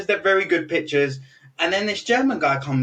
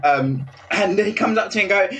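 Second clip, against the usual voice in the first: ordinary delivery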